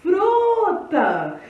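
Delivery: loud, high call with a long stressed "Fru"; calling someone out. Shown as a demonstration. Teacher calling fruta up to a third-floor window, loud drawn-out call. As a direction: shout "Fruta" up to a window, stretching the first syllable. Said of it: "Aí eu chamo ela".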